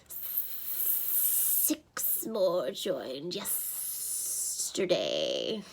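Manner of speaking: very slow, soft snake hiss; reptilian voice. A narrator's reading. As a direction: draw out every S like a serpent. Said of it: "a reptilian voice".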